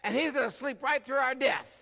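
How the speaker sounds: high, loud, incredulous outburst; shocked that he can sleep now; perplexed disbelief. Retold or imitated. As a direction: disciples aghast at the sleeping Jesus.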